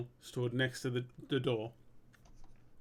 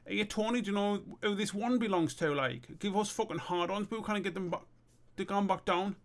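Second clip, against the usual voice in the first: high-pitched character voice; pleading explanation; 8 semitones higher than usual. Acted. Lad asking Tony for help, high-pitched pleading.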